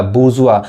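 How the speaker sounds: बुर्जुआ as "boo-zu-aa", no r; correct pronunciation. Shown as a demonstration. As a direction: drop the r in बुर्जुआ. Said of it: "क्या पढ़ाएगा".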